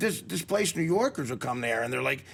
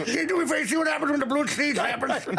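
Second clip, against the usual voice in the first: high, hammed-up impression of a stuttering man; comic mimicry pitched way up; imitating his speech; 9 semitones higher than usual. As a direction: push the put-on voice high for laughs. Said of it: "he talks like this".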